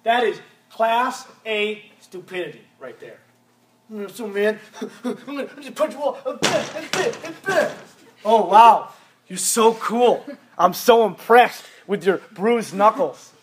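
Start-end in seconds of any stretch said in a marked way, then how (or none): 3.9-7.7 rising, mocking dumb tough-guy voice. Youth pastor mocks a teen who punches walls, goofy tough-guy voice. 8.2-13.3 sarcastic. Acted. Sarcastic fake admiration.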